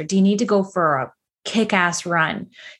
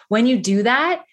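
usual speaking voice

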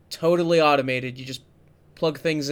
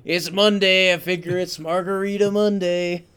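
put-on thick Boston accent, raised and loud; 4 semitones higher, 4 dB louder than usual. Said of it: "this guy with this super thick Boston accent".

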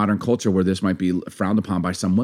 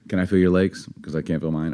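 quiet corny come-on; cheesy pickup line; 4 semitones lower, 5 dB quieter than usual. Pretend. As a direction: lightly play a cheesy flirt.

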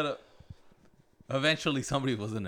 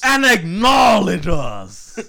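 much higher, much louder, very slow shout; shouted catchphrase; 10 semitones higher, 13 dB louder, much slower than usual. Pretend. Co-host shouting the wrestling line, very slow. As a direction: bellow it like a wrestling promo.

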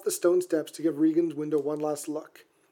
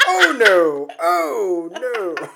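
laughing; 6 dB louder, much slower than usual. Real, not acted. Laughing fit over Duffman.

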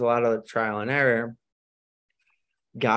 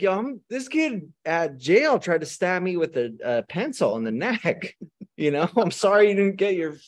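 laughing sarcastic; 7 semitones higher than usual. Retold or imitated. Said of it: "I'm like".

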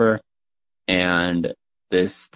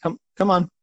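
high little gnome voice; beckoning gnome; 10 semitones higher, faster than usual. Acted. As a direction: urge them along in a small, high voice. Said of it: "and he says".

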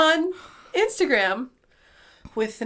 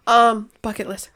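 ordinary talk

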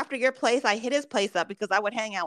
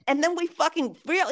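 ordinary delivery

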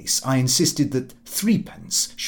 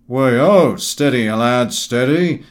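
loud hearty call, slowing on the last steady; booming shout to steady the rocking table; 8 dB louder, slower than usual. Acted. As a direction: bellow it like calming a bolting horse. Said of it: "Gowing shouted out".

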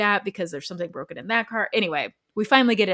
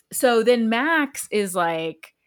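ordinary talk

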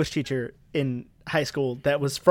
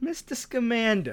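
mock American accent, higher and softer; teasing high-pitched imitation of an American accent; mimicked movie quote; 7 semitones higher, 7 dB quieter, slower than usual. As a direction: slowly parrot a bad American accent through a laugh.

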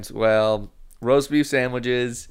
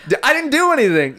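whiny protest; 11 semitones higher, 4 dB louder than usual. Acted. Voicing accused man protesting.

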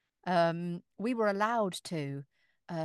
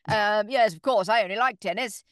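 posh mock snobbery; 10 dB louder than usual. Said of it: "my standard R P accent".

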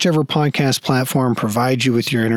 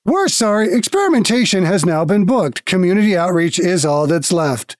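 guild automaton; 5 semitones higher than usual. Acted. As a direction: speak like a polite machine at a desk.

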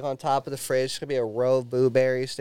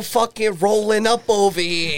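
slow, higher Italian-American wiseguy; Italian mobster ghost; 5 semitones higher, 3 dB louder than usual. Acted. Friend playing the carpet-wrapped ghost, Italian mobster voice. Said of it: "just wrapped up in a carpet".

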